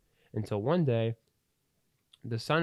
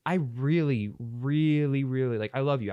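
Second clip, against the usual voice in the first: emphatic plea; 3 semitones higher, 5 dB louder than usual. Retold or imitated. Dad's emotional plea to son.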